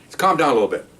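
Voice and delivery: ordinary talk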